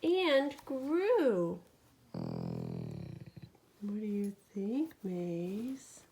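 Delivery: lively bedtime storytelling; animated picture-book reading; reading to a child. A narrator's reading. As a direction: read it to a sleepy toddler. Reads bedtime story aloud.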